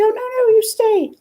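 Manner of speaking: very high put-on lady's voice; high-pitched imitated protest; insistent hostess. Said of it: "she said".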